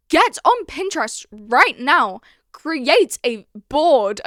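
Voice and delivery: slow, emphatic command; mock-stern order. Vlogger ordering viewers to create a board, mock stern. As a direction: spell out the order word by word.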